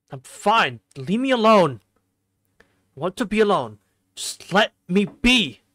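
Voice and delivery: loud, high outburst pushing someone away; angry shout. Acted. Shiki telling Hisui to leave him alone, shouting. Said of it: "Shout that I run up".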